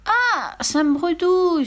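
very high pained yelp; child squealing in discomfort; squirming boy. Acted. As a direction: yelp as if writhing. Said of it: "se tord-il en tout sautant".

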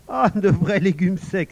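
exclamation forte et traînée de fillette; s'exclamer devant les fleurs fanées, lentement; s'écrie. Acted. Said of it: "s'écria Pony". (Pony s'écrie fort et lentement devant les fleurs fanées)

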